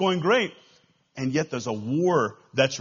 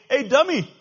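higher, louder mocking crowd voice; heckling fish; 6 semitones higher, 5 dB louder than usual. Other fish jeer at the salmon swimming upstream, acted heckling. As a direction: call out in disbelief, as a heckler. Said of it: "rest of the fish, if they can talk, are saying".

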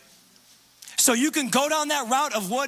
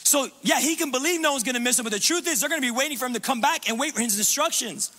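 ordinary talk, nothing marked

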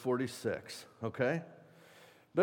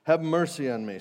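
louder plea; 3 semitones higher, 6 dB louder than usual. Acted. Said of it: "he shouted all the more".

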